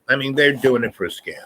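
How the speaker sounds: normal talking voice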